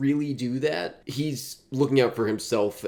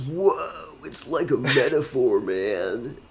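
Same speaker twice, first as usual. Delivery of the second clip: high stoned surfer drawl; 4 semitones higher, slower than usual. Acted. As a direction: lay it back like a beach surfer. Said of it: "Can you do that voice in every".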